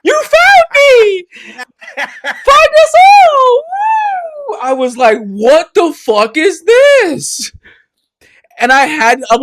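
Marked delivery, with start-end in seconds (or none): 0.0-4.6 put-on very high, excited little-boy squeal; gleeful child calling out, high and loud. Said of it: "This little boy, like". 5.2-7.5 high, drawn-out disbelief; slow, baffled cry; incredulous outburst. Retold or imitated. Retells shocked reaction.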